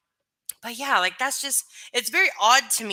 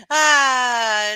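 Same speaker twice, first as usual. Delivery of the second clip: loud high laugh; 5 semitones higher, 8 dB louder than usual. Real, not acted. Streamer laughs loudly at own therapy joke. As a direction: burst out laughing.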